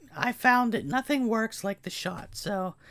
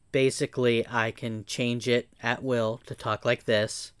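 put-on deep voice, much lower pitch; deeper voice; 10 semitones lower than usual. Shown as a demonstration. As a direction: drop your voice way down low. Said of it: "I can make it deeper".

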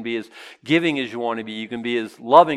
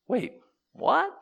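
surprised what; 12 semitones higher, 3 dB quieter than usual. Acted. Mock surprise at the scripture.

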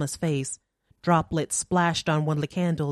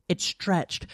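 plain reading voice

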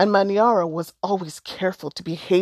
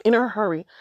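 plain reading voice

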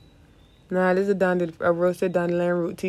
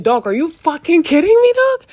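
loud high disbelieving shout; outraged yell; 10 semitones higher, 11 dB louder than usual. Retold or imitated. Retold outrage at the doctor.

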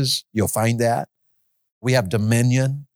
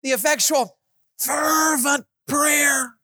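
high, slow, shouted fervent prayer; 11 semitones higher, much slower than usual. Shown as a demonstration. Preacher shouting the verse to show fervency. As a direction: belt out fervent, stretching it. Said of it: "What does that word feel like to you".

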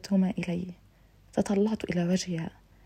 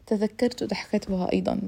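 plain reading voice

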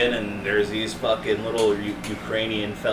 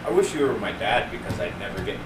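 ordinary talk, nothing marked

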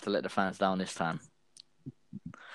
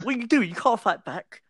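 mock indignation; 13 semitones higher than usual. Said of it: "He finished that, like".

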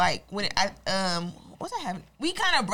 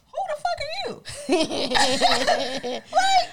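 laughing; 4 dB louder, much slower than usual. Real, not acted. Laughing at a rude comment.

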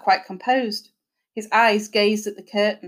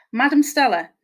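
plain reading voice

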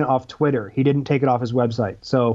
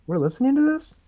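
bewildered listeners; 10 semitones higher, 3 dB quieter than usual. Retold or imitated. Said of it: "people are going".

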